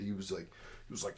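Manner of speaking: usual speaking voice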